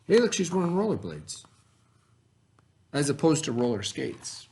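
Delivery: ordinary talk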